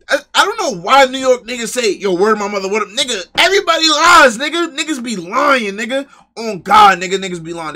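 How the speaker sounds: high, loud, exasperated ranting; worked-up rant. Real, not acted. Streamer to viewers about people swearing and lying, worked-up loud rant. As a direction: shout it out like you've had enough.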